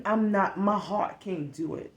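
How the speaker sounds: ordinary delivery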